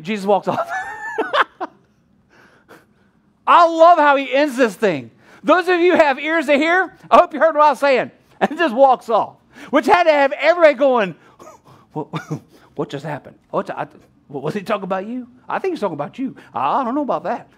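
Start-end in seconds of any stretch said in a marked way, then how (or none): 0.7-1.6 a bright high laugh bursting out; high burst of laughter. Real, not acted. Preacher laughing at Jesus walking off, high burst of laughter. 11.9-17.5 low, soft muttering of a puzzled crowd; confused crowd. Retold or imitated. Crowd whispering to each other after Jesus leaves, soft puzzled mutter. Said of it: "crowd confused after Jesus left".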